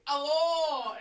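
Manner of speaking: loud, drawn-out hello; sudden mock holler; calling out. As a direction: burst into a long shouted greeting. Acted shout in retold story.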